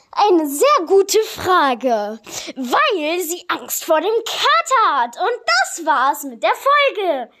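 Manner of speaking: high, loud show-host delivery; hammed-up joke telling. Host telling cheap jokes to listeners, hammed-up and loud. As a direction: belt out corny jokes, high and drawn-out.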